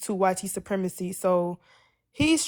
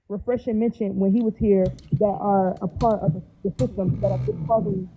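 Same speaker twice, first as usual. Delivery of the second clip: muffled mic; 4 dB louder than usual. Real, not acted. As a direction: voice dulled as if through a wall. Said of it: "Muffled, darling".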